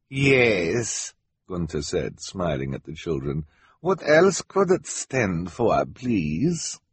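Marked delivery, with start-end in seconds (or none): 0.1-1.1 put-on drawn-out foreign-accented yes; smug fake foreigner, higher voice; villain Gunter. 3.8-6.8 slow, higher fake foreign accent; villain Gunter.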